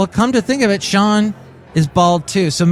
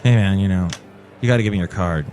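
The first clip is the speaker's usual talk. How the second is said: lower-pitched dismissive retelling; curt, rude cashier; 7 semitones lower, faster than usual. Retold or imitated. Store worker asking customer for the card, mildly rude. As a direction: imitate an unfriendly store worker. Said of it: "even kinda actually rude to me".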